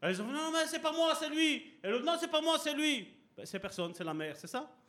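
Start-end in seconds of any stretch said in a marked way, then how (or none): 0.3-3.1 put-on high childish whine; children blaming. Children denying blame to their father, higher childish voices. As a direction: play the kids pointing at each other.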